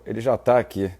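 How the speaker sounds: normal talking voice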